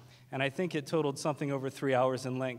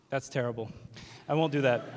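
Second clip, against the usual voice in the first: ordinary talk, nothing marked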